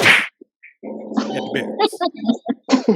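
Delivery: "At the barracks" stretched very slowly; drawn-out whisper. Shown as a demonstration. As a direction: stretch the words out with a knowing grin. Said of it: "repeats it drawn out".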